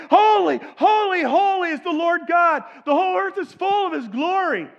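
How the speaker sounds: high, loud, ringing cry; angelic proclamation. Acted. Angels crying out before God's throne, loud and ringing. Other angels answer loudly.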